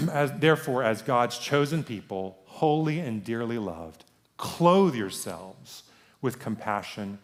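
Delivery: slow, weighty reading of the verse; scripture reading. Acted. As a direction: read it out slowly, giving each phrase weight. Reads scripture aloud.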